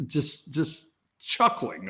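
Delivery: usual speaking voice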